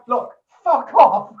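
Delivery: loud, high, slow look fuck off; slow angry shout. Retold or imitated. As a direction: bellow it slowly to drive him away. DJ shouting at drunk guest.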